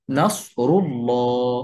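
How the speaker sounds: drawn-out recitation with a heavy, dark "ল্লা" in "নাসরুল্লাহ"; heavy lam. Shown as a demonstration. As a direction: slowly hold a full, rounded "llaw" in each "ল্লাহ". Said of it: "সেই লামটা মোটা করে উচ্চারণ". ("ল্লাহ"-এর লাম মোটা করে, টেনে উচ্চারণ)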